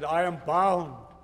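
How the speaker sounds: mild tearful voice; weeping. A narrator's reading. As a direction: hold on through tears. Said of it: "Annie, weeping, answered".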